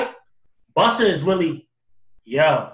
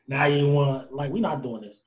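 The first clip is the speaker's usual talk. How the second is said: ordinary talk, nothing marked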